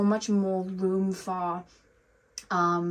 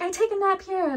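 very high, louder put-on American kid voice; attempted American accent, slipping Irish; 11 semitones higher, 5 dB louder than usual. Reader mimicking the meme kid wanting a nap, wobbly American accent. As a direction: do an American kid badly, veering towards Irish. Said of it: "I sounded Irish".

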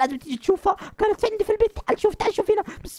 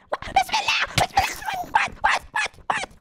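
much higher alarmed cries; high, jolted panic; startled; 14 semitones higher than usual. Acted. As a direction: jump in fright. Monster jumps out.

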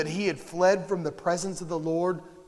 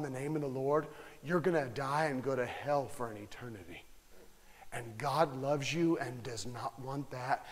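gently urging the listener to repent; tender altar call; 5 semitones lower, 10 dB quieter than usual. Acted. Preacher softly urging listeners to call on the Lord, tender and hushed.

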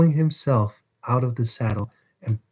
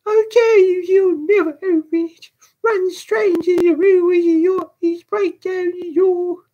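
put-on very high, loud, urgent boy; frantic high-pitched child's yelling; boy's scream; 6 dB louder than usual. A narrator's reading. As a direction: scream directions to the rescuers outside. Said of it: "screamed the boy".

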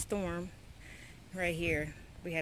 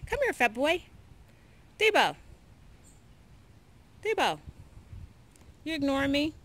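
high, loud call to the dog; 7 semitones higher, 5 dB louder than usual. Real, not acted. Owner calling her dog Debo, high and loud. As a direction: call him over, raised and high.

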